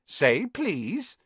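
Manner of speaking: high, teasing lilt; annoying sing-song. Acted. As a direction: needle him, rising at the end. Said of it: "in his annoying sing-song voice".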